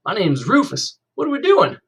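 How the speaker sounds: all eager grin, reaching for a friend; bright eager greeting from the new boy; smiling real big. A narrator's reading. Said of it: "smiled at me real big and said".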